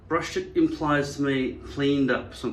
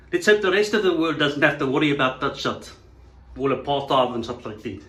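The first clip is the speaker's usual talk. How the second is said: put-on louder, thick South African accent; 4 dB louder than usual. South African dismissing worries about race, put-on South African accent. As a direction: repeat it as a broad South African.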